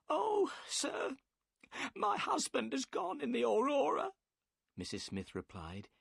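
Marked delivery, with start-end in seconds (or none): 0.1-4.1 high, crying woman's voice; the wife breaks down in tears; bursting into tears. A narrator's reading. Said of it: "and burst into tears".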